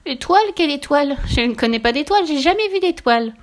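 high, loud squeaky piglet protest; piglet in denial. A narrator's reading. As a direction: deny it all in a high piglet voice. Piglet denies, voiced in story. (petit cochon qui nie, voix aiguë et forte)

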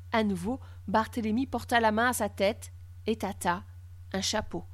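ordinary delivery, nothing marked

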